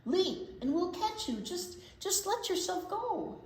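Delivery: calling up. Acted. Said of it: "the crowd yelled".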